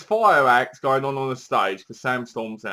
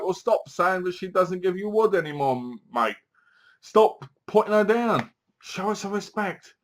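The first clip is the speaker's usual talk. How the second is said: higher-pitched exasperated telling-off; mock scolding; 6 semitones higher than usual. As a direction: tell him off on her behalf. Acts out viewer scolding Sam.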